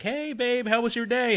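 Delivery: high, bright sing-song hello; girlfriend's greeting. Retold or imitated. Girlfriend walking in the door, high sing-song greeting.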